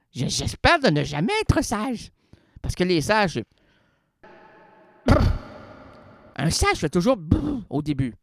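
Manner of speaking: ordinary talk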